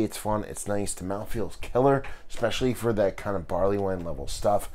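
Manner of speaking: ordinary talk, nothing marked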